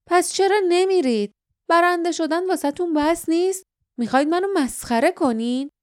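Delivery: high-pitched, exasperated questions; annoyed challenge. A narrator's reading. Guardian asking winners why they stay, annoyed.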